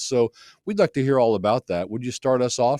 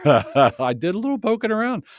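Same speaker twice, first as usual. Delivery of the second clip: laughing; 10 semitones higher, 4 dB louder than usual. Real, not acted.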